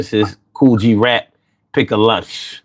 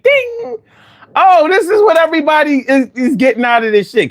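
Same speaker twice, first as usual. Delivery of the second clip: high, fast hammed-up revelation; mock excited realization; 10 semitones higher than usual. Retelling the moment weed finally hit him, excited. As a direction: burst out like it just clicked.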